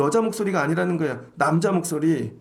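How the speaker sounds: ordinary talk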